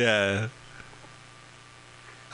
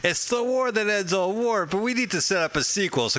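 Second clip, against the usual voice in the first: mock pitch; 10 semitones higher than usual. Mocking officials planning a sequel.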